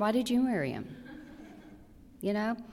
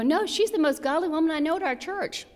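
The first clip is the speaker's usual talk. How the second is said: higher, incredulous protest; wife protesting to husband, high and incredulous; disbelieving protest; 9 semitones higher, 4 dB louder than usual. Retold or imitated. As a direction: defend her in disbelief. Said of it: "I go".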